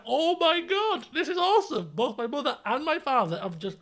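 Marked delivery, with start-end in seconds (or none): 0.0-1.9 put-on thrilled kid. Boy watching parents blast teacher, high thrilled delight. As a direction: savour the teacher getting told off. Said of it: "It's like".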